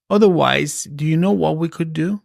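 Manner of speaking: ordinary talk, nothing marked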